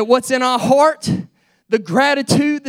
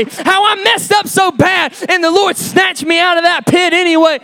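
high, shouted revival preaching; fervent pulpit shouting with hard-hit phrases; full-force preaching; 7 semitones higher, 6 dB louder than usual. Real, not acted. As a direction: preach it out in loud, driving bursts.

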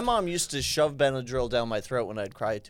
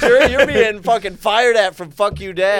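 loud, raised-pitch taunting; mock threat; 5 semitones higher, 9 dB louder than usual. Podcast host threatens the DJ in jest, mock menacing. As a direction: shout a blustering warning, half laughing.

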